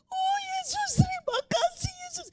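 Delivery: high, soft, weeping thanks; crying soft and high with relief; tearful crying. Retold or imitated. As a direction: choke out thanks through tears. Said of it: "Nangis saya".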